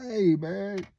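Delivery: hollering at something in the dark, half-acted; mock calling out. Calling out in retold scare.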